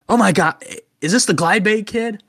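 high, loud imitated delight; excited recognition. Pizzeria owner answering the phone to the kid, imitated excited recognition. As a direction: mimic someone thrilled to recognize the caller, pitch way up.

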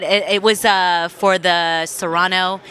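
ordinary delivery

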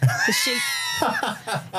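mock anguish.

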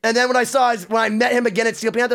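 ordinary talk

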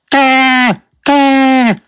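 high cawing bird squawk. Retold or imitated. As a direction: squawk it like a crow outside the window. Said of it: "How are you gonna edit out".

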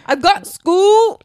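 high, slow, loud mock Scottish "school"; mock Scottish accent. Friend joking about Scottish accents, loud hammed-up Scottish voice. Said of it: "I love a Scottish accent".

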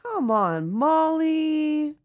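playful exasperation with a character; exasperated pleading. Pretend. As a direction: groan at her to see sense, loudly.

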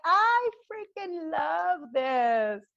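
high, drawn-out mock squeal of delight; réaction enchantée. Acting out delighted reaction to image.

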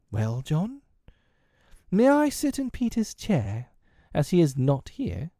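put-on high polite boy voice; boy's voice. A narrator's reading. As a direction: ask like a hopeful boy. John asks as child.

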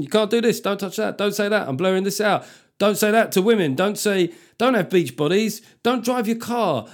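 imitating a bossy politician; mock scolding politician. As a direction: rattle off the bans like a killjoy politician. Mimicking a politician's list of prohibitions.

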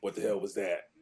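raspy, scolding veteran voice; rough rasp, calling him out. Retold or imitated. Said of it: "he had this real raspy voice".

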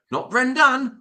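"Brendan" punched high and loud on a drawn-out last syllable; exaggerated mispronunciation. Mock list of mispronounced name variants.

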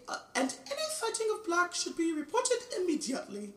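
a high, hammed-up British news accent that lands on Immediately; bad British accent. Finishing the TV news warning in a mock British accent. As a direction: stretch out a clumsy British accent to the end. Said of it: "I have the worst accent, governor".

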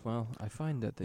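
dull, flat, bored monotone. Pretend. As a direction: drone it like a dull lecture. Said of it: "talk about tax loss harvesting in monotone voices".

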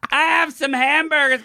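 high, slow call down the stairs; mom yelling down. Retold or imitated. Mom calling down to the basement, high and slow. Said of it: "His mom keeps yelling down".

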